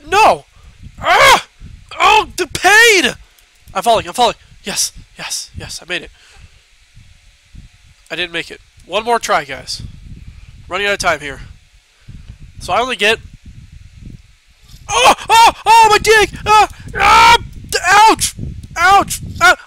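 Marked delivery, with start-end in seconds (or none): 0.1-3.1 high pained yelling; screaming while falling. Acted. Game character falls, pained screaming. 14.9-19.6 high, loud pained yelps; screaming while falling. Acted. Game character falls and gets hurt.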